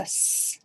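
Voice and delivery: "S" as a drawn-out hiss, not the letter name; S sound. Shown as a demonstration. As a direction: hold the consonant "S" as one long sss. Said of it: "makes a S sound".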